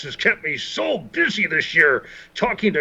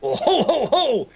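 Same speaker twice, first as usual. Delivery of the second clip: high, hearty ho ho ho; Santa laugh; 8 semitones higher than usual. Acted. Santa signing off the net, hearty and high. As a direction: laugh like Santa saying goodbye.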